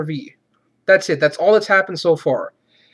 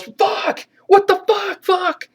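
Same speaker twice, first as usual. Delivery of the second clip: high-pitched mock yelling; imitated yell; much slower than usual. Man in the motorhome just yells, mock panicked yell. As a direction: yelp it high, mocking his helpless panic. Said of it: "he just yells".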